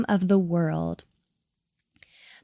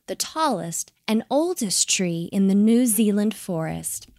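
plain reading voice

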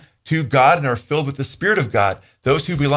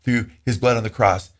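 usual speaking voice

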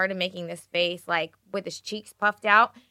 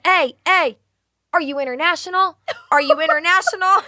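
high, loud calling out; retold yelling; 9 semitones higher, 7 dB louder than usual. Sleep-talking husband to wife across the room, yelling. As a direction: yell an urgent question across a room. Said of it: "he started yelling".

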